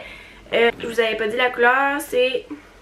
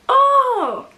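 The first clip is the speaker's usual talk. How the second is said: sudden high loud Oh!; high startled exclamation; 12 semitones higher, 4 dB louder than usual. Real, not acted. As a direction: yelp of surprise at her own hair. Realises it's her hair.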